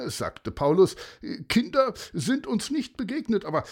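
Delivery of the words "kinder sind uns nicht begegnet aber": high, hesitant character voice; friendly helper character. Acted. Paulus answering the searching mother, hesitant. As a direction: answer a worried stranger, pitched up.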